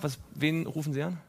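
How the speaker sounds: ordinary talk, nothing marked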